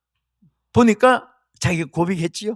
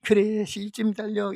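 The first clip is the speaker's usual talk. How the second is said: soft, slow, listless encouragement; mock uninterested parent; half-hearted cheering; 7 dB quieter, slower than usual. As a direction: cheer as if you barely care. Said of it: "이렇게 하는 부모가 없습니다".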